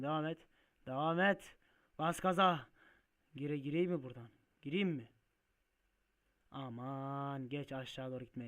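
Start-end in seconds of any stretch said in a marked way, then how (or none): none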